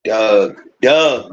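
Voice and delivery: "Doug" said hard, dog in a Baltimore way. Retold or imitated. Imitating how Baltimore folks say dog as "Doug". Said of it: "They used to say it hard, bro".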